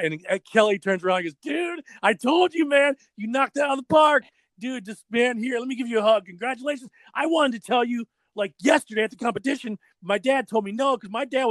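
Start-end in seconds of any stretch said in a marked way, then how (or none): 1.4-11.5 high, gushing imitation of a thrilled friend; excited congratulations. Kelly welcomes his friend to the Vision team, excited. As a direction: burst out with congratulations, pitched way up.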